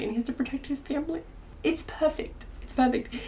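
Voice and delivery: very high, squeaky choked-up voice; overcome with emotion. Real, not acted. Reader saying the ending is perfect, overcome. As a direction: let it climb high as if about to cry.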